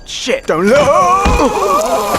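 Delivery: screaming a warning in horror; panicked scream. Acted. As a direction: scream it in terror.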